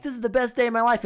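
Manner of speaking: high, quick happy quote; retold happiness. Host voicing Buddy in the bridge conversation, happy and excited. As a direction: rush it out in imitated glee. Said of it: "he's all happy".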